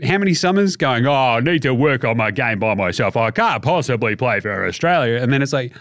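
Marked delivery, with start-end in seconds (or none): 1.1-5.2 lower, lightly voiced excuse; mock whiny Simmons. Host mimics Simmons excuses for skipping Australia, lightly mocked. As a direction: whine the excuse.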